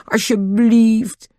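slow, drawn-out please; pleading. Acted. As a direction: beg for it sweetly. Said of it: "vroeg Nobby smekend".

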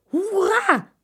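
high, loud shout of joy; happy cheer. A narrator's reading. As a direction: cheer at the invitation. Joris delighted by the invitation.

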